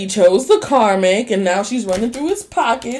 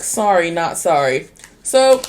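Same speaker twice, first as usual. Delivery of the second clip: usual speaking voice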